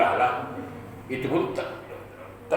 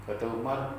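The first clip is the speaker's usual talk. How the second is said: ordinary delivery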